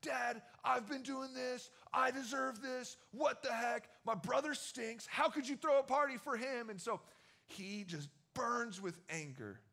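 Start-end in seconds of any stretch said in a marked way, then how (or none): 0.0-6.7 raised, higher indignant complaint; angry older son. Retold or imitated. Older son protesting to his father, higher and indignant. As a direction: whine at dad that it's unfair. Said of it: "so upset with his dad".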